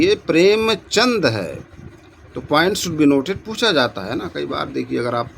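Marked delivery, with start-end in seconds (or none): 0.0-1.5 प्रेमचंद said slowly, ending in a plain चंद with no रा; careful spelling stress. Shown as a demonstration. Teacher corrects students' spelling, slow and pointed on प्रेमचंद. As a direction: lean on प्रेमचंद, slow and clear, no द्र at the end. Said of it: "दा में रा नहीं है".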